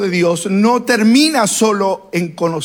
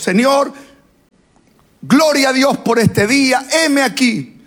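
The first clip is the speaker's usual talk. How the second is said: higher-pitched voice of a believer praying aloud; 6 semitones higher than usual. Acted. Believer praying to the Lord as we do, prayerful. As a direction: call up to God in eager prayer. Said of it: "que oraba como nosotros".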